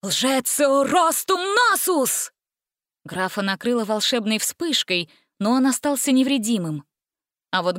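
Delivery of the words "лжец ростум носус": slow, high incantation; drawn-out wizard incantation; cried spell. A narrator's reading. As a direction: chant out a magic spell. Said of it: "выкрикнул заклинание".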